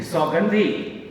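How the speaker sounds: a man shouting his lover's name in fury; angry shout. A narrator's reading. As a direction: shout her name in anger. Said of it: "shouted angrily".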